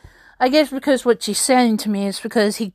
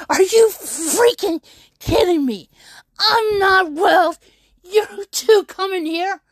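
upset protest; 6 semitones higher, slower than usual. Retold or imitated. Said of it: "I was like".

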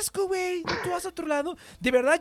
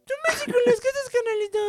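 much higher whiny mock barrio voice; mock barrio guy; 13 semitones higher than usual. Repeating his friend's line as a joking barrio character, much higher. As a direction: echo the line in a cartoonish street-guy voice. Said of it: "si tienes como mucho barrio".

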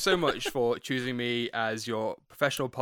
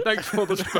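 laughing; 7 semitones higher, 5 dB louder than usual. Retold or imitated. Mocking repeat of Matt's line.

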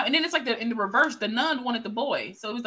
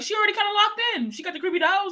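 high, quicker mock voice for the demons; imitated scheming demons; 10 semitones higher than usual. Demons sizing up the woman with the creepy dolls, high mock voice. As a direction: pitch it way up, imitating their reasoning. Voicing the demons picking their victim.

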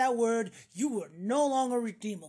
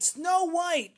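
mock-dramatic announcement; 6 semitones higher, 7 dB louder than usual.

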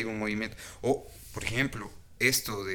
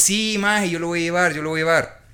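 eager acted promise, higher and louder; eager agreement; 4 semitones higher, 6 dB louder than usual. Friend readily promising to bring the oil, eager. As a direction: pipe up keenly as the willing friend.

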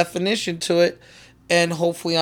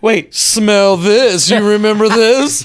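louder playful taunt; teasing; 4 semitones higher, 5 dB louder, slower than usual. Pretend. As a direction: dangle it in his face. Teasing friend with drugs.

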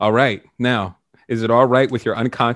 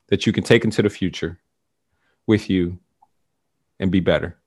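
guided meditation; 3 semitones lower, 3 dB louder than usual. Acted. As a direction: lead them gently down the timeline.

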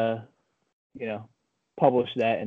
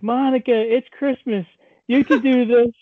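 high, louder coaxing call; pleading with coworker; 9 semitones higher, 4 dB louder than usual. Retold or imitated. Retelling a plea to Monica at work, high pleading. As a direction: plead up high, pushing the volume.

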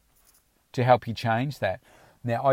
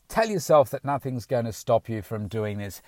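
ordinary talk, nothing marked

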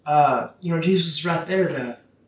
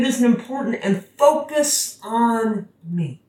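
high, slowed-down plea to focus; Jesus urging; 5 semitones higher, slower than usual. Retold or imitated. Jesus tells Peter to focus on him, slowed and pleading. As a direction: lean in on focus on me as Jesus, slowly. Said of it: "He's like".

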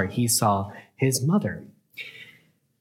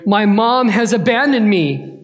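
put-on higher, louder child's wail of alarm; panicked boy; 8 semitones higher, 6 dB louder than usual. Lost boy realizing his mom is gone, panicked. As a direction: cry out like a lost kid.

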